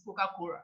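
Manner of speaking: COCA-COLA pronounced Coca-Cora; R in place of L. Shown as a demonstration. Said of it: "or your O R".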